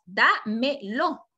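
"Dámelo" very slowly as da, me, lo; slow, split-up teaching demonstration of "Dámelo"; three separate syllables. Shown as a demonstration. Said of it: "palabra de tres sílabas".

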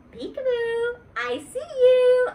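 high, loud, slow singsong peekaboo; shy girl bursting out in play. Acted. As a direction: tease the boy playfully. Playing peekaboo with Warren.

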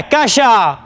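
pleading shouts, stretched at the ends; pleading call. Acted. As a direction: call out to a lover, begging to be heard. Man calls to Kasia, begging forgiveness.

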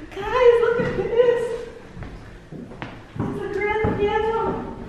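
high, slow thrilled squeal; shrieking jackpot winner. Real, not acted. Discovering grand piano in room.

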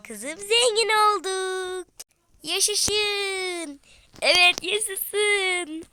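high, loud, slow celebration; jubilant. Acted. Family celebrating getting rich, high and loud. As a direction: cheer it out.

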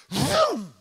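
loud, high vroom of a plane; plane engine vroom. Retold or imitated. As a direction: make the roar of the plane. Imitating a plane.

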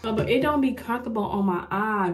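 ordinary delivery

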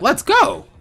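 high, loud burst of joy; shocked excitement. Retold or imitated. Player amazed the enemy didn't end, cheering high and loud. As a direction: cheer it out, thrilled and stunned. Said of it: "So I was super shocked".

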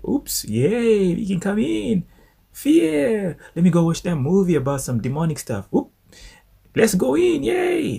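very high squeaky cheer of a mischievous spirit; gleeful fear. Retold or imitated. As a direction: play fear sneaking in delighted. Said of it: "fear is like".

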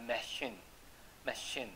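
"machine" with a soft sh in the middle. Shown as a demonstration. Teacher giving an example of French CH, slow "machine" with sh. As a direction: say "machine" slowly as muh-SHEEN. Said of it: "C H呢念嘘的有几个字".

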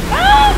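sudden high yelp of fright; jump-scare shriek; startled scream. Real, not acted. Sudden startle while playing.

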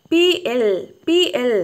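name each letter പി then എൽ; spelling out letters. Shown as a demonstration. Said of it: "ഒന്നിച്ച് വായിക്കുമ്പോൾ എങ്ങനെയാ വായിക്കുക". (അക്ഷരങ്ങൾ ഉറക്കെ വിളിച്ചു പറയുന്നു)

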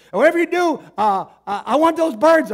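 high, loud desperate shout; arrested man shouting to the cops; yelling. Retold or imitated.

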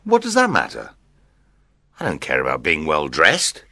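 offhand groom shrugging it off; acted nonchalance; careless. A narrator's reading. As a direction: wave it away, dropping into a low rasp. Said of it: "Petruchio replied carelessly".

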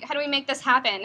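ordinary talk

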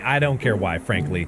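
deadpan dismissal. Real, not acted. Gamer brushing off friend's question, low dismissive. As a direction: drop it flatly, done with it.

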